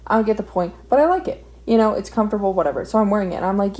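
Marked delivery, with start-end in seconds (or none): none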